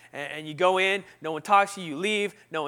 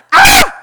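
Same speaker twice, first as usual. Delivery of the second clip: very high, loud shriek; short comic scream of terror; panicked scream; 22 dB louder than usual. Retold or imitated. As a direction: scream as if caught out. Mock terror at being exposed.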